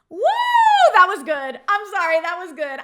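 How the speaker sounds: loud, high burst of approval; thrilled, loud reaction; delighted whoop. Real, not acted. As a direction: whoop and cheer the burn on.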